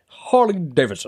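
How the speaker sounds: imitating an odd accent on Harley Davidson; mimicking his friend's odd pronunciation of Harley Davidson; mock accent. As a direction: copy the weird way he said Harley Davidson. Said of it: "what type of accent is that".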